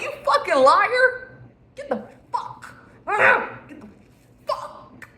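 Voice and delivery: high, loud, laughing pretend outburst; hammed-up telling-off while cracking up; laughing mock yell. Telling an ex to get out, in a high, laughing mock yell.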